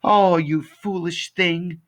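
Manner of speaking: high, slow wail of dismay; grieving old woman's cry; distressed lament. Acted. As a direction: lament it, drawing the words out. Woman finds her cat dead.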